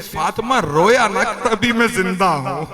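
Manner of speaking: acted tearful comforting; tearful plea. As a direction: console a daughter as if through tears. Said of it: "और रो के कहते हैं".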